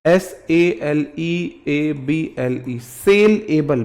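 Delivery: each letter slow, then सेल एबल split in two; slow spelling out. Shown as a demonstration. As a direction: spell it slowly, split the word. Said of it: "दो word तो होते हैं". (धीरे-धीरे spelling बोलना)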